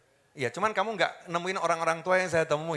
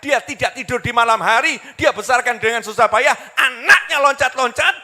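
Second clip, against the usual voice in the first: loud, higher, driving sermon build; impassioned preaching; 8 semitones higher, 9 dB louder than usual. Real, not acted. Preacher reproaching mothers of today, impassioned preaching. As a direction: preach it at full force, indignant.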